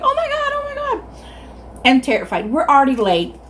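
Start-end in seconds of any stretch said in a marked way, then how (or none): 0.0-1.0 mimicking screaming girls. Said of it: "girlfriends are screaming".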